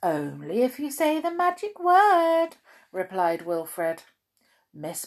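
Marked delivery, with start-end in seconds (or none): 0.0-2.6 wizard voice. Acted. Wizard speaks.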